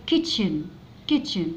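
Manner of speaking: kitchen with a clear ch sound; word modelled. Shown as a demonstration. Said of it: "بتتنطق تش". (نطق kitchen بوضوح)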